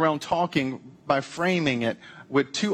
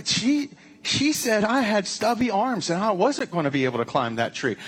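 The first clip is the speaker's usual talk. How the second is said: lightly voiced, higher whiny chimp complaint; a touch of an offended little primate; hurt animal gossip; 6 semitones higher than usual. Pastor playing a chimp complaining about an insult, lightly voiced.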